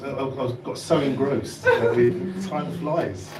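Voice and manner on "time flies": ordinary talk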